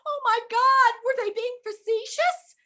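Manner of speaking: high, loud, panicky self-talk; squealing oh my God in a panic; panicked. Retold or imitated. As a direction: spiral into alarm. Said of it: "And I'm like".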